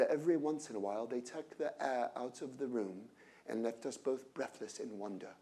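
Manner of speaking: put-on a gentle, awed British accent; British accent impression. Speaker quoting Jony Ive's memorial, soft British accent. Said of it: "I won't try to impersonate him anymore".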